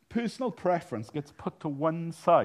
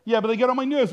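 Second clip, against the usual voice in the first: higher, louder mock grumble; mock whiny complaint; 6 semitones higher, 6 dB louder, faster than usual. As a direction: mimic a petty church member griping. Mock quote of complaining member.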